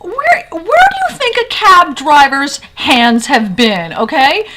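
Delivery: high, loud, slow mock outrage; imitated appalled scolding. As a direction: act out a disgusted friend hammering the question home. Friend scolds her over cab fries.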